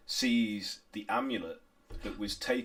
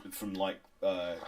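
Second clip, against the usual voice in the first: ordinary talk, nothing marked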